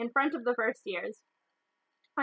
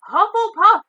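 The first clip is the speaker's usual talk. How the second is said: much higher, loud announcing shout; hat shouts; 10 semitones higher, 13 dB louder than usual. A narrator's reading. The hat announces the house to everyone, shouting. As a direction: bellow the house to the hall. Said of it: "shouted the hat".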